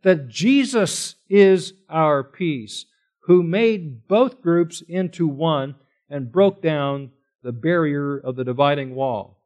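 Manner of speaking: slow, deliberate statement of the point; slow down to drive the point home; slow emphasis. Shown as a demonstration. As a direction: spell it out word by word. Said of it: "So the point is".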